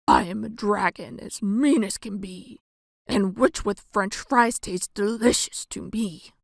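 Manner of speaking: ordinary delivery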